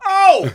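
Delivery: very high, loud shocked cry; startled yelp. Retold or imitated. Narrator bracing for his turn, loud startled cry. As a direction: burst out in alarm. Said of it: "I was gonna go".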